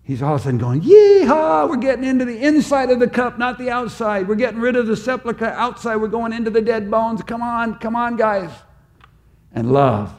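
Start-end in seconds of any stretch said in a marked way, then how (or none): 0.8-8.5 high, whooping excitement; playfully acted exuberant whoop and cheer; gleeful rallying cry. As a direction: burst out with a yeehaw, then rally everyone along up high.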